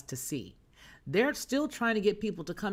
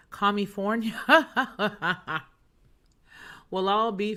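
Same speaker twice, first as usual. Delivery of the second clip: laughing; 3 semitones higher, 5 dB louder than usual. Real, not acted. Laughs mid word at comment.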